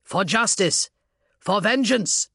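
rally the troops into the charge; rousing battle cry. Acted. Officer leads charge, battle cry.

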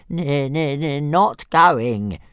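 high, slow, stuttering dragon refusal; stubborn little dragon. Acted. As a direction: dig in and refuse like a stubborn pet. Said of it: "said Toothless stubbornly".

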